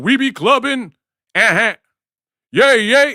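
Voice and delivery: high, loud hyped [name] voice; imitating [name]'s high excited yelps; [name] hype.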